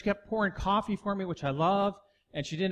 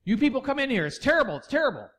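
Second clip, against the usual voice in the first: louder, fast agreeing oh yeah; amen-section manager; 3 semitones higher, 6 dB louder, faster than usual. Retold or imitated. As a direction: back her up loudly and quickly. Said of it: "her amen section's the manager saying".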